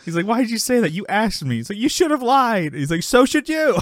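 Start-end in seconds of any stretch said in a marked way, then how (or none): 0.3-1.6 high-pitched retold back-and-forth; mock quarrel between friends; acted bickering. 1.8-2.7 high-pitched scolding comeback; imitating an exasperated friend; mock reproach. Retelling an acted quarrel. 3.0-3.8 high, louder retort; hammed-up sitcom bickering; quarrel comeback. Retelling an acted quarrel.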